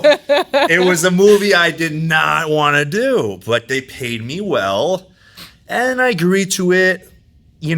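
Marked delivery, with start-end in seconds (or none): 0.0-3.4 slow, laughing through it, higher; laughing restatement. Shown as a demonstration. Guest repeats to podcast hosts, slow and laughing. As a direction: repeat it slowly while laughing.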